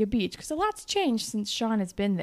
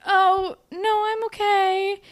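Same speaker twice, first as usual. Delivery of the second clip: much higher, airy voice waving off help; high girly brush-off; 12 semitones higher, 8 dB louder, slower than usual. Retold or imitated. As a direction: play the sweet girl politely saying no. Girl brushes off stranger's question.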